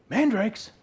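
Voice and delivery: high, loud exclaimed question; excited surprise. Acted. Childless sister hearing of fertility fruit, excited surprise. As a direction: pounce on the word with sudden interest. Sister eagerly reacts to the mandrakes.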